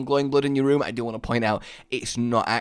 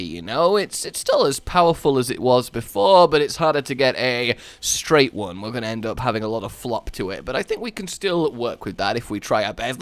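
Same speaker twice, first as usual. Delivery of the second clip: ordinary delivery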